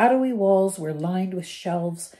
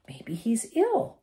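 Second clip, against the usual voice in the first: higher, slower, a hopeful lift; 6 semitones higher, slower than usual. Acted. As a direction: say it like you wish it were true. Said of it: "said Ron hopefully".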